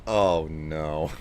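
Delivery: ordinary talk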